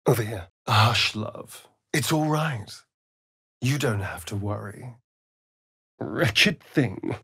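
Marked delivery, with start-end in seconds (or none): none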